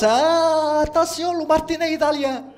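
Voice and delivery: high mock warning from the mother; shrill-free high call of mom's threat, voiced up high; imitating mother's warning. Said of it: "titre très exotique de martinet italien". (la mère qui menace les enfants, voix aiguë imitée)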